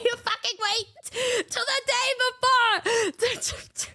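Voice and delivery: slow, high, half-crying laugh; laugh-cry exasperation. Retold or imitated. Scolding her friend, slow and laugh-crying. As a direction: drag it out, laughing and whining.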